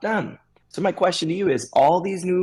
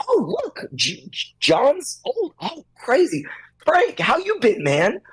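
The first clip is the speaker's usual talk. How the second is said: mock excited greeting; 10 semitones higher, slower than usual. Friend greeting others at store.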